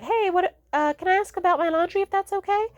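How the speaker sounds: very high, hesitant imitation of a polite request; tentative asking. Daughter asking mom about overdue laundry, high tentative imitation. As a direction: tiptoe into the question, high-voiced.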